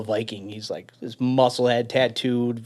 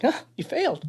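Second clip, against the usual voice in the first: mocking laugh. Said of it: "he's like".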